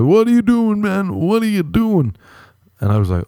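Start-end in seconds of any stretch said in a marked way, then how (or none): 0.0-2.2 high-pitched mock-alarmed yelp; startled protest. Dude walked in on in the bathroom, startled protest. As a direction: imitate a man caught out, pitched way up.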